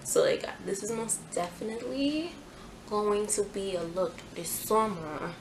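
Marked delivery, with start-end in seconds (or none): none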